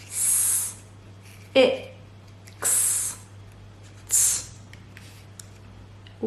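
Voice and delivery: "S, it, x, t" as isolated phonemes, consonants voiceless; separate letter sounds. Shown as a demonstration. Teacher adding sound buttons, each sound said separately. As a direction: sound out each piece softly with long gaps. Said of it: "put the sound buttons in this word".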